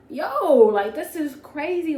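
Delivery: loud, high, slow fake shock; mock amazement. Reading his messages in front of him, loud fake shock. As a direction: ham up the disbelief.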